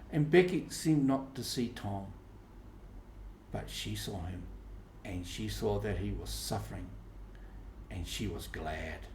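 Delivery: ordinary delivery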